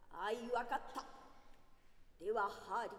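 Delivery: emperor voice. A narrator's reading. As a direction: speak with royal weight. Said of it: "皇帝フランツ一世が堂々と入場".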